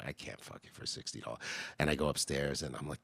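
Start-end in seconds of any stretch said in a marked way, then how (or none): 0.1-1.4 low, fast hushed whisper; whispered refusal. Retold or imitated. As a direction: whisper it back so nobody hears. His whispered reply in club.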